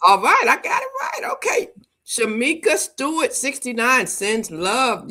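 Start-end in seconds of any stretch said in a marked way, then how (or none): 0.1-1.7 high-pitched, loud celebratory cheer; delighted squeal of success; excited triumph. Real, not acted. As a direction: cheer at getting it right, voice shooting way up. Glad she pronounced the name right.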